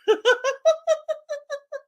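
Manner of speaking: high-pitched burst of laughter; laughing at her own joke; high laughing fit. Real, not acted. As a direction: let a real laugh bubble out.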